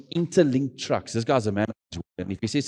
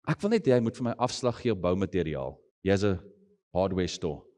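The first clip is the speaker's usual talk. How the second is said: ordinary talk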